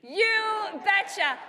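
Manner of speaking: loud, high, drawn-out mocking cheer; very slow, shouted crowd chant; Tom Tiffany catchphrase. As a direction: belt out a borrowed catchphrase, stretching both words. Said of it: "as Tom Tiffany would say".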